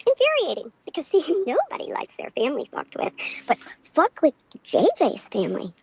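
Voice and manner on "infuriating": high repeat. Shown as a demonstration. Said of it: "It's motherfucking infuriating".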